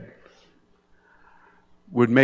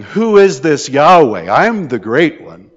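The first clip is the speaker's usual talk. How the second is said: gloating king; 5 semitones higher, 8 dB louder than usual. Retold or imitated. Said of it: "gloating and goading and saying".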